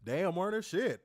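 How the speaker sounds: usual speaking voice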